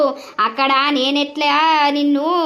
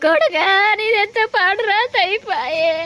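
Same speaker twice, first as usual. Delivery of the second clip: wailing; 5 semitones higher than usual. Acted. Mother wails over son.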